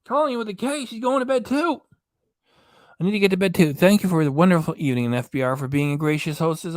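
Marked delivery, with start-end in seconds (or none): 0.0-0.9 high sing-song. Real, not acted. Sing-song reading of viewer name. 0.9-1.8 high sing-song. Real, not acted. Reading out viewer's goodnight.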